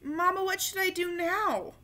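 put-on boy asking his mother; child asking. A narrator's reading. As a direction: ask Mama for help. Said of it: "Tony asked".

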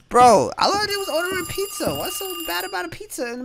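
high, loud protest; exasperated shout. Real, not acted. Gamer protests the game for judging his pizza, loud high outrage. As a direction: yell in disbelief at the game.

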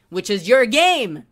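loud, slow angry outburst; furious shout. Real, not acted. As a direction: hurl the last words at them. Ranting at company.

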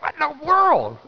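high-pitched, baffled exclamation; acted astonishment. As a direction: voice leaps up in mock shock. Acts out astonishment at the sight.

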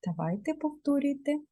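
ordinary talk, nothing marked

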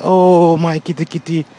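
high, loud Oh then cooing my kitty; calling the kitty. Retold or imitated. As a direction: exclaim then coo at the cat. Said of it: "I say".

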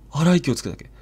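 usual speaking voice

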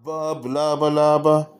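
playful nonsense mouse noises; silly babble. A narrator's reading. Daddy making silly mouse faces, babbling playfully. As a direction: babble it like someone pulling faces.

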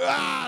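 loud, high groan of effort; acted-out exertion cry; straining shout. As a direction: yell out as the weight goes up. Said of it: "I will never shout in the gym, but that day".

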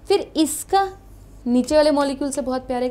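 normal talking voice

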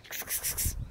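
soft unvoiced kitty call; cat-calling kiss sounds. Real, not acted.

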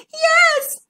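high, loud storybook wail; weeping. A narrator's reading. Frightened Zara answers her cousin Zigbee, weeping. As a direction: wail it like a weepy child. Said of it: "wept Zara".

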